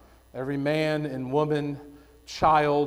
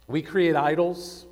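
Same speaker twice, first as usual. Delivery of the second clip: ordinary talk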